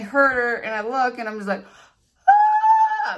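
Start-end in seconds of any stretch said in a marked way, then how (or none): 2.3-3.2 sudden high shriek of alarm; startled high scream; panicked scream. Retold or imitated. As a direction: shriek like you just saw something awful. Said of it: "I'm panicking".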